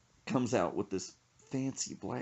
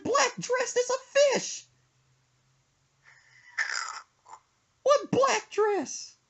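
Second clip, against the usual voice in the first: high slow disbelieving falsetto; incredulous; 4 dB louder, slower than usual. Retold or imitated. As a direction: stretch out the question in disbelief. Son retelling his disbelief.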